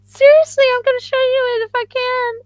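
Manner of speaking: very high, loud excited squeal. Real, not acted. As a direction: squeal with real delight over the tiny tooth. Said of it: "I go into a very high pitch when I got really excited".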